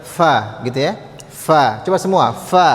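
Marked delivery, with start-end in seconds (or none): 0.0-0.6 "Fa" with air let out on the F; F with air released. Shown as a demonstration. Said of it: "harusnya dikeluarkan udaranya". 1.3-1.8 "Fa" with air let out on the F; F with air released. Shown as a demonstration. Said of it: "harusnya dikeluarkan udaranya". 2.3-2.7 "Fa" with air let out on the F; F with air released. Shown as a demonstration. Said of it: "harusnya dikeluarkan udaranya".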